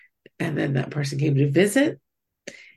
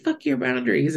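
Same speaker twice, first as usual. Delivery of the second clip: mock angry snap; 7 semitones higher than usual. Friend's outburst repeated.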